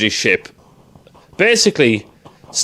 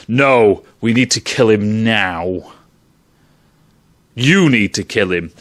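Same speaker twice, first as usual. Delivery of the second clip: low, cold voice of the predator dad; stern father; 6 semitones lower than usual. Retold or imitated. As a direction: drop low and give the order like a hard father. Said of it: "Instead, Dad goes".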